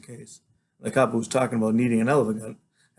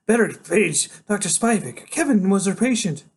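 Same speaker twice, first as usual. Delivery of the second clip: higher-pitched concern, as a character; alarmed nurse; 9 semitones higher, 4 dB louder than usual. A narrator's reading. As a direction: voice a worried nurse, pitched well up. Nurse voiced on seeing him.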